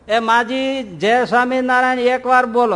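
put-on coaxing shout to a deaf old woman; calling out. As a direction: shout to get her to say it.

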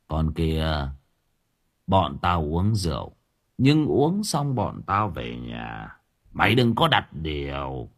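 low, slow, sneering drawl; contemptuous thug. Acted. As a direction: look down on her and drag it out. Dũng answers contemptuously. (giọng khinh khỉnh trầm và chậm)

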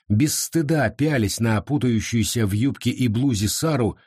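usual speaking voice

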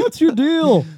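hammed-up high, loud comedian impression; Jerry Seinfeld. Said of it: "I could do a Seinfeld".

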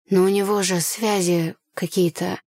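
usual speaking voice

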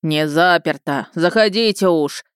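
slow, booming gnome voice, set apart from the narrator; thunderous voice. A narrator's reading. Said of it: "раздался чей-то громовой голос".

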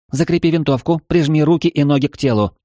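high, tense guiding voice; urgent AI instructions. Acted. Eva gives rapid instructions.

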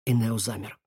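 ordinary delivery

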